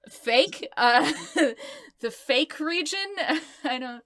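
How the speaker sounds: high, laughing, questioning tone; giggling guess. Real, not acted. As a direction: break into giggles while groping for an answer. Laughing at own fake accent region.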